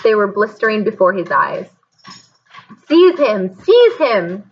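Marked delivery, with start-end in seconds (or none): 2.9-4.5 high, loud repeated shriek. A narrator's reading. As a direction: shriek it twice, losing control. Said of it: "shrieked Voldemort".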